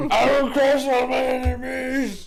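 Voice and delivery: hammed-up high giant bellow; mock barbarian shout, laughing. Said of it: "your voice has gotta be lower than that".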